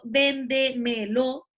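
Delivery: Véndemelo slowed into ven-de-me-lo; syllable by syllable. Shown as a demonstration. Teacher showing an assembled word, syllable by syllable. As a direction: pull Véndemelo apart piece by piece. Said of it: "es una palabra que está armada".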